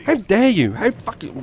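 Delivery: high-pitched indignant fuming; enraged muttering. Retold or imitated. Walking round the room raging, indignant muttering to self. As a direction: re-enact fuming to oneself, voice pitched right up. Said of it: "I was there muttering to myself".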